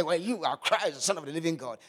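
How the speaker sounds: loud, confident proclamation, no stammer; bold declaration. Pretend. Peter declaring to Jesus, imagined loud and bold. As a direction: proclaim it boldly to the whole room. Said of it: "never think Peter was so bold to say".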